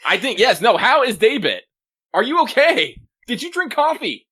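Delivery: high, worked-up comic disbelief; hammed-up alarm over a fashion disaster; mock exasperated concern. Mock alarm over character's odd outfit.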